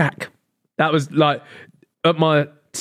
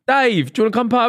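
mock call; 8 semitones higher than usual. Mock mate calling for Dave.

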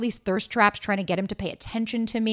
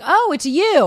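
fake casual surprise; 8 semitones higher, 7 dB louder, slower than usual. Playing it cool with crush.

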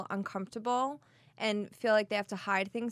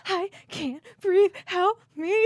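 high, loud mock panic; retold panic attack, breathless and pleading; panicked, can't breathe; 9 semitones higher, 8 dB louder than usual. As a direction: gasp out a hammed-up plea for help, drawn out. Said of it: "I have a complete panic attack".

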